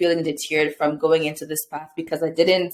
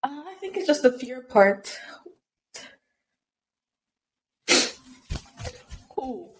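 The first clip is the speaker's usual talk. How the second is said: high, soft voice through real tears; choked-up apology; emotional, tearful; 6 semitones higher, 4 dB quieter than usual. Real, not acted. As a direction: struggle to answer while crying. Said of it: "I'm getting emotional".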